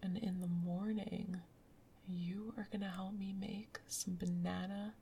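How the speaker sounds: usual speaking voice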